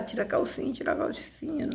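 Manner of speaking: tira a calcinha sussurrado, bem baixinho; sussurro com medo. Retold or imitated. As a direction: sussurra pra mãe não ouvir. (irmã mais velha sussurrando, com medo da mãe)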